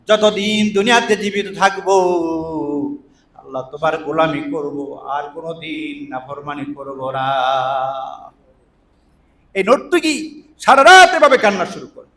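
usual speaking voice

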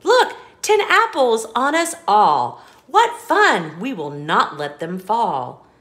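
put-on bouncy storybook reading for a child; lively read-aloud. Parent reading an apple-stacking book to a child, sing-song. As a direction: read it like a bedtime picture book, rhythmic and bright.

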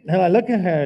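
preacher shouting to punch the story; sudden loud burst. Real, not acted. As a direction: blast the line out.

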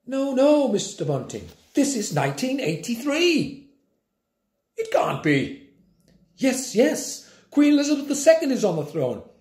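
high, condescending schoolmistress; prim teacher. A narrator's reading. Teacher lecturing Grandpa before the class, high and condescending. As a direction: talk down to him sweetly.

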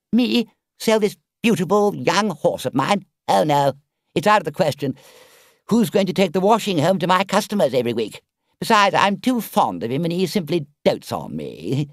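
high, loud, boastful Toad; a vain toad feigning outrage, then angling for a price; Toad's voice. Acted.

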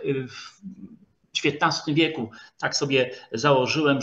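usual speaking voice

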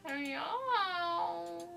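high, drawn-out meow; a long wailing cat meow. Pretend. Imitating the cat.

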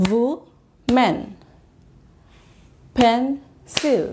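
"woman", "pencil", "water", "beautiful", "citizen", "festival" split into separate syllables with clear gaps. Shown as a demonstration. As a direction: break each word into its syllables, pausing after every one. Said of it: "প্রতিটা শব্দের প্রতিটা অংশ বলবার সময়".

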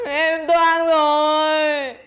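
very slow, drawn-out mock wail; crying. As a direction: drag it out as a tearful lament. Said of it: "đừng khóc".

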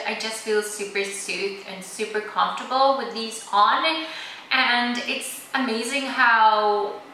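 lips stiffened by mask; through a sheet mask. Real, not acted. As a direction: face covered while talking. Seen 2: Gold sheet mask covers whole face, mouth hole, throughout speech.

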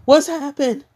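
high, fast, bewildered questions; confused Ruby. Retold or imitated.

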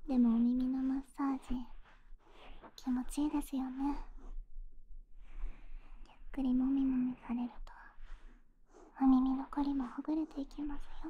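soft close whisper; right in your ear. Acted. Whispered massage.

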